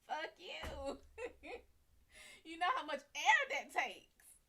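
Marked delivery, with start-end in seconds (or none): 0.1-1.6 laughing. Real, not acted. Laughing at the crowd's request.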